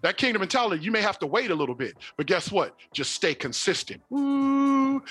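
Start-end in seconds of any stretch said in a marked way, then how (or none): 4.1-5.0 high, sustained whoop; long exclaimed ooh. Real, not acted. Pastor relishing his point about staying consistent, whooping.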